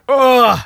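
loud gagging groan; disgusted groan. Real, not acted. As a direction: let out a sick, loud ugh. Gagging after chugging the drink.

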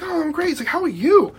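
very high, overly cheery reply; imitated chipper friend. Freezing friend insisting he is fine, mock chipper high voice.

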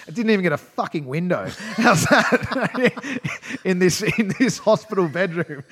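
high and loud, laughing through the words. Real, not acted. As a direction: laugh in disbelief at the room. Laughing at the windowless room.

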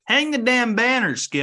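high, loud playful holler; mock rallying cry. Podcaster heckling co-host over tournament record, mock rallying cry. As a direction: belt it out in mock outrage.

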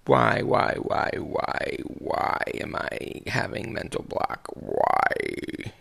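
frustrated muttering. Real, not acted. Frustrated mumbling during a mental block.

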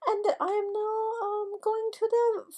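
high Kurt voice. Acted. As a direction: lilt it up high and gentle. S1 voicing Kurt's story.

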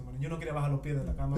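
usual speaking voice

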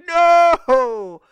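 high, loud wail of no; anguished "No!". Retold or imitated. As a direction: cry out no in horror.

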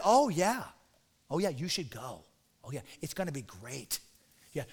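low, hushed mock encouragement; sarcastic. Micaiah playing around with King Ahab, sarcastic. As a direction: egg them on with soft, dripping sarcasm. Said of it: "he said it sarcastically".

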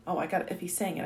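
ordinary delivery, nothing marked

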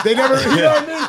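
cracking up mid-sentence; laughing. Real, not acted. Host cracks up retelling the fight story, laughing.

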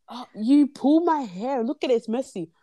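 mock whiny complaint. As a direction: mimic her dragging out the grievance. Retelling girl's complaint in quarrel.

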